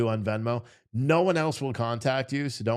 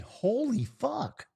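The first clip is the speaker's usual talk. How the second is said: amazed; 12 semitones higher, much slower than usual. Real, not acted. Reacting to the concession.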